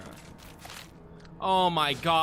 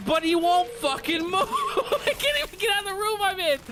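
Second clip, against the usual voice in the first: high, fast words between fits of laughter; laughing in disbelief; 9 semitones higher, faster than usual. Real, not acted. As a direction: lose it laughing while complaining. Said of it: "almost started crying from laughing".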